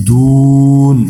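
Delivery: "দুন," with a long held u; three-alif stretch. Shown as a demonstration. Teacher demonstrating madd, long-drawn "দুন,". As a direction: draw "দুন," out three counts before the n. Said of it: "এটাকে তিন আলিফটা নিয়ে পড়তে হবে".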